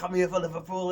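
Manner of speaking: high, loud proud fan's declaration; proper excited. Retold or imitated. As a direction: announce your team at the top of your voice. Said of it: "I was proper excited".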